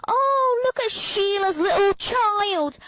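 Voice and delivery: high, gushing mock-mum, slow and loud. Teen mocks mum cooing over Sheila's child, hammed-up high gush. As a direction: coo it like a nosy mum showing off gossip. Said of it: "when you come over to me and seeing".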